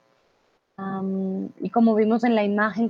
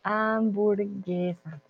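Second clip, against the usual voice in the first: Hamburguesa said softly and slowly; soft slow model word; 6 dB quieter, much slower than usual. Shown as a demonstration. Teacher modelling the word for Chris, soft and slow. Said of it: "no burger, hamburguesa".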